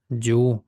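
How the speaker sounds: "Дю" with the vowel held long. Shown as a demonstration. Teacher repeats the word for learners, "Дю" with long vowel. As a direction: stretch out the vowel of "Дю". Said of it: "довга голосна".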